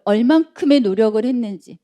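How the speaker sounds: usual speaking voice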